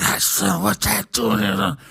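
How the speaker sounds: put-on slow, mumbling old man; muffled old-timer imitation; old billionaire. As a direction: mumble it like a doddering old codger. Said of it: "but it was like more like".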